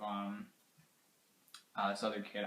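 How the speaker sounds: ordinary delivery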